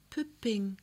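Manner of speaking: soft, hushed sleepy call; soft child's call. A narrator's reading. Child asking for its doll in the dark, soft and hushed. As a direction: breathe the name out sleepily. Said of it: "flüstert es leise".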